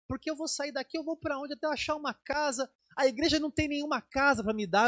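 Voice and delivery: a hint of an anxious old woman; old lady worrying. Dona Terezinha asking the church for a house, mildly worried. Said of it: "Ela disse".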